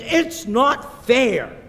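put-on high, loud, drawn-out child's complaint; pouting child's yell. Olivia yells at her mother in the kitchen, pouting yell. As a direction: act out a six-year-old yelling in a pout. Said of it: "and she yells at".

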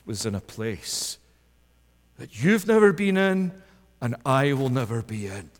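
usual speaking voice

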